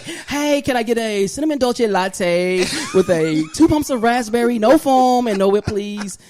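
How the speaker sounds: high, lilting coffee order; campy ordering voice. Acted. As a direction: put on an affected, camp café-customer voice. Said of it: "That's not how I talk".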